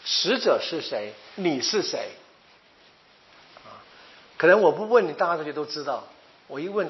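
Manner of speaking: normal talking voice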